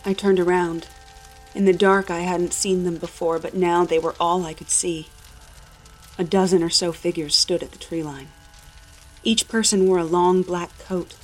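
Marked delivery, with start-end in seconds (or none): none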